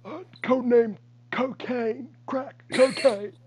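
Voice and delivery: high, slow put-on voice; mock villain politician. Friend keeps the politician bit going slowly. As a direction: drawl out the codename.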